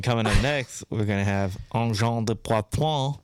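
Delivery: French-Canadian radio announcer voice; Quebecois accent. Pretend. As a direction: read the names with a Quebecois accent. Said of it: "his great Quebecois accent".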